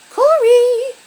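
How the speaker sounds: high, very loud sustained call; calling shout. A narrator's reading. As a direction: holler the name up the stairs. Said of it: "stopping by the stairs to shout".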